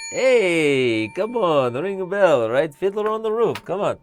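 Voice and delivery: excited plea. Acted. Streamer hams up excited plea.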